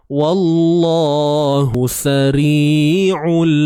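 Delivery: drawn out, melodic tajwid chanting; slow Quranic recitation. Shown as a demonstration. Teacher recites verse for students, slow chant. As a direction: chant each syllable slowly and evenly. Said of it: "teacher recites slowly".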